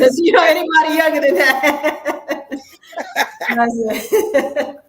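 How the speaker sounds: loud, high laughing remark; laughing shout. Real, not acted. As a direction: blurt it out laughing. Host jokes about audience age.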